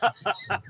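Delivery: short high laugh after surfacing; breathless laugh. Real, not acted. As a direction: laugh out the held air. Laughs after releasing held breath.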